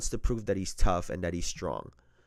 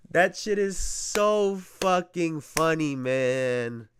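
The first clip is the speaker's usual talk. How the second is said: slow, through laughter; giggling and amused; laughing; 7 semitones higher, slower than usual. Real, not acted. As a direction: crack up while saying it. Laughing at the video.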